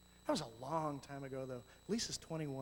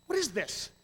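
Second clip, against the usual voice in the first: louder, higher, half-mocking bewilderment; mock startled outburst; baffled by tears; 6 semitones higher, 6 dB louder than usual. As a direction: act out surprise at your own crying. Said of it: "you're going".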